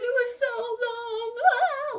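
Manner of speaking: put-on high, drawn-out loud child's wail; hammed-up bawling, words stretched into a howl; mock crying. Little Stephanie telling how she missed her, mock wailing. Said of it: "she was crying".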